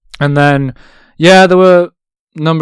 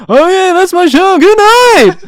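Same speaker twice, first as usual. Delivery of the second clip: high, loud and slow mock comedian goodbye; Seinfeld sign-off; 13 dB louder, slower than usual. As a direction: drag out the abrupt ending like a hammed-up Seinfeld. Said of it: "and he went".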